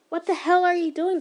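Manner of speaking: angry yell. Acted. A stranger yelling at the slayer protecting a demon, angry shout. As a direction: bark the question at him. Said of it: "the person yelled".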